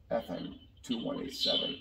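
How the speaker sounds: tinny electronic helmet voice filter; helmet voice changer. Shown as a demonstration. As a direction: speak through a cheap helmet voice changer. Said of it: "you got the voice Changer feature".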